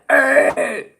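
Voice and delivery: hammed-up stretched call; imagined exaggerated group voice. Imagining lesbians with a way of talking, exaggerated call. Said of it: "lesbians aren't like".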